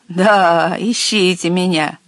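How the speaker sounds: gloating inner taunt; drawn-out, gloating mockery. A narrator's reading. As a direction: think it with malicious glee. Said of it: "злорадно думал маленький водяной".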